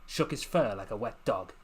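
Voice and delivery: plain reading voice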